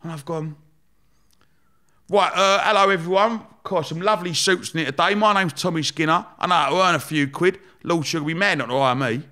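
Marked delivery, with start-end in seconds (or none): 2.1-9.2 cheeky pitch. Retold or imitated. His cheeky interview pitch.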